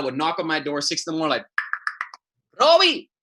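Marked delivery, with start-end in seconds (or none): 2.6-3.1 put-on high loud call of an old Cuban grandma. Abuela calls grandson to wake him. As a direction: call his name through the door like abuela. Grandma calling through the door.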